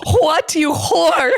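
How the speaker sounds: slow, high, laughing shout; mock shout. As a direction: bellow it slowly, laughing.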